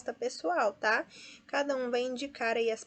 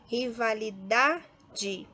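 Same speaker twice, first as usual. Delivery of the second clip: "Rivalidade" very slow, stressed on DA; penultimate stress; much slower than usual. Shown as a demonstration. Teacher sorts words for students, slow "Rivalidade" stressed on DA. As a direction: stretch "Rivalidade" by syllable, leaning on "da". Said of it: "também paroxítona".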